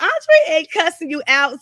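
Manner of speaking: mother-in-law waving off her son's whining; mother defending. Retold or imitated. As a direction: brush off the son's complaint, loud and high. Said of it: "She was like".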